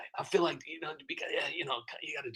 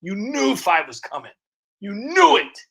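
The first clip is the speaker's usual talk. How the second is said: rising louder and higher on "knew"; building anticipation; 8 semitones higher, 8 dB louder than usual. Real, not acted. Creator building up to five golden rings, excited emphasis. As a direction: hit "knew" harder each time, climbing.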